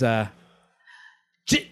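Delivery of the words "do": high, slow, slurry impression. Rock star asks an actor about his lines, high slow impression. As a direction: draw the question out in a high, puzzled voice. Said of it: "And he goes".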